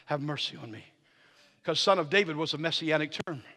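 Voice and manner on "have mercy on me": quiet paraphrase. Retold or imitated. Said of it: "What he just said is".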